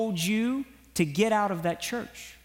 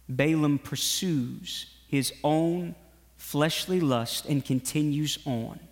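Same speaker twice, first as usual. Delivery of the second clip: ordinary talk, nothing marked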